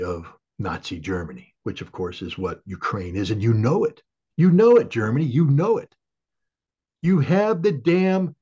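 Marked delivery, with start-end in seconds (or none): 3.3-8.4 loud, high-pitched furious outburst; a real angry rant, much louder and higher; angry shouting. Real, not acted. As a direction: shout it straight at Germany, livid.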